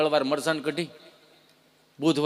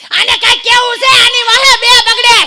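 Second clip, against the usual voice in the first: grandma yelling; 16 dB louder than usual. Retold or imitated. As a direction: shout it across the whole courtyard. Grandmother shouting across yard. (બા આખા ફળિયામાં સંભળાય એમ ઊંચા અવાજે બૂમ પાડે)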